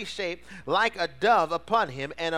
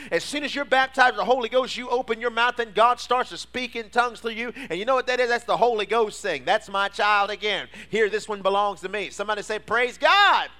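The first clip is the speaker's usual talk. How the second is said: high, loud climbing sermon cry; preaching at full force; 6 semitones higher, 6 dB louder than usual. Acted. Pastor rousing the church to full force preaching. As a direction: preach it up, higher and louder.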